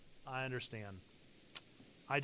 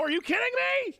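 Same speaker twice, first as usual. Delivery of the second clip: ordinary talk, nothing marked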